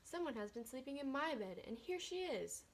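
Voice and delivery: put-on squeaky Baby Bear. Baby Bear finds the girl in her bed, high squeak. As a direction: squeak it out like a tiny cub.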